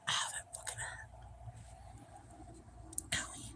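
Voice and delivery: whispered pain. Real, not acted. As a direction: whisper through the sting. Unvoiced pained line.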